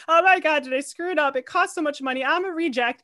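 high, panicky self-quote; retold freak-out. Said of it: "I'm freaking out".

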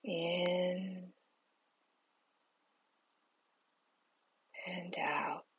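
slow, soft whisper, guiding each breath; whispered guided meditation. Acted. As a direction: breathe the words out slowly, barely voiced.

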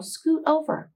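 high, eager squirrel voice. Acted. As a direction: chirp it like a little squirrel. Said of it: "said the squirrel".

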